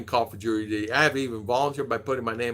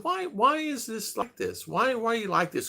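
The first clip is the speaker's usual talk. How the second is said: mock plea; 10 semitones higher, 3 dB quieter than usual. To the big sheriff, a high pleading whine.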